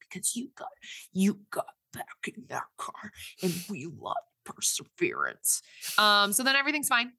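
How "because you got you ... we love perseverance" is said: hushed, breathy whisper of the sponsor's line; whispered quote. Retold or imitated. Podcaster retelling the sponsor's answer to the driver, whispered. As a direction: whisper it like a secret good news.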